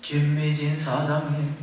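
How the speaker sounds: low, very slow, eerie voice; ghostly call. Retold or imitated. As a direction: draw the name out like a ghost in a dark hall. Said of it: "귀신 같잖아요".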